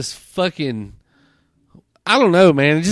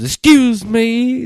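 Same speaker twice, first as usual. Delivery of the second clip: slow, high, louder "Excuse me" mimicking the creep; mock polite guy; 9 semitones higher, 5 dB louder, much slower than usual. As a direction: stretch it out in a higher, oddly polite mock voice. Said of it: "He goes".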